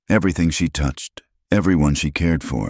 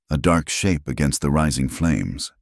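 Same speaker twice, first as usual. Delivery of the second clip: usual speaking voice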